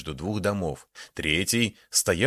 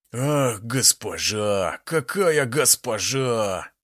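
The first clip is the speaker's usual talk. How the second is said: slow leering admiration; 2 semitones higher, slower than usual. A narrator's reading. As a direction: savor each word like a lecher. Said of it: "восхитился Герлен".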